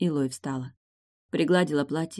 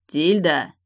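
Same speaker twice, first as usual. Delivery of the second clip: louder, urgent call of a name; raised tone; 5 dB louder than usual. A narrator's reading. Morton calling unresponsive Tilda, tone raised. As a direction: call out to someone not answering. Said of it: "повысил он тон".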